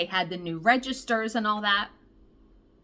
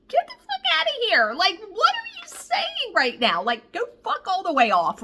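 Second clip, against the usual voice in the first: incredulous outburst; 10 semitones higher than usual. Real, not acted. Disbelief at Bird Lady's remark.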